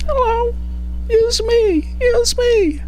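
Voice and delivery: tiny high-pitched pleading voice; squeaky object voice. Pretend. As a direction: beg like a little toy. Object begging to be used.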